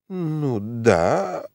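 put-on lost, hesitant old prince, slowing; flustered. A narrator's reading. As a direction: fumble for an answer. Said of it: "отвечал растерявшийся князь". (растерянно мямлит старый князь)

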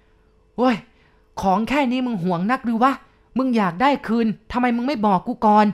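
high, loud furious outburst; enraged shout. A narrator's reading. Father snaps after his child collapses, furious shouting. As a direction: lose all patience and yell. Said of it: "พ่อลุกขึ้นตะโกนลั่นเหมือนกับว่าตะบะของพ่อแตก".

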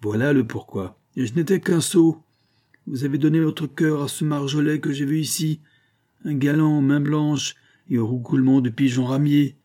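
scornful jeer, rising high and loud; sneering. A narrator's reading. Jealous husband who found the lover's letter, jeering sneer. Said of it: "reprit-il en ricanant".

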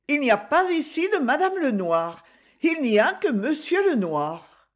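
high-pitched little maid correcting a visitor; maid's voice. A narrator's reading. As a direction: answer in a high servant-girl voice.

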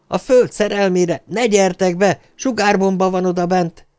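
high, pleading shouted warning; frantic yell. A narrator's reading. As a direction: desperately warn people away, pitched high. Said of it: "üvöltötte". (magas hangon, kétségbeesetten kiáltva)

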